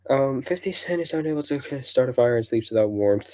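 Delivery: usual speaking voice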